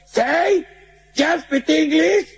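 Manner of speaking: very high, slow squeal; high mock English. Mexican trying English on the gringo, very high and slow. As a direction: stretch it out absurdly high.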